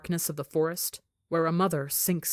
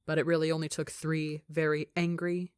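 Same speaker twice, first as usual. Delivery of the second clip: ordinary delivery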